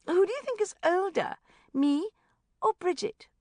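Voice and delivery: high, loud, coy question; playful coaxing. A narrator's reading. Rebecca quizzing the child with a wink, playful. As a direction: lead the child to the answer.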